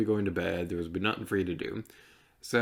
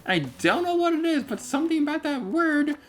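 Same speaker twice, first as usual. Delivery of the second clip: silly high voice; 5 dB louder than usual. Acted. High put-on voice explaining lisp.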